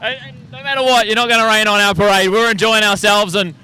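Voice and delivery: loud, rowdy rival banter; defiant shouting. Real, not acted. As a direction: shout it proudly over the rain.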